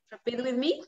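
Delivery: ordinary delivery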